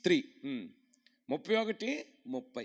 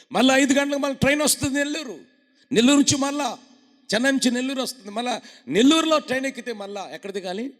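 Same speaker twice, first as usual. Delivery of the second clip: loud, higher, fast sermon delivery; preaching; 10 semitones higher, 13 dB louder, faster than usual. Acted. Preacher telling the congregation about the train, loudly. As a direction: drive the story home to the church.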